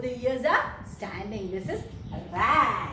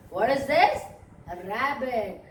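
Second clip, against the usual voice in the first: normal talking voice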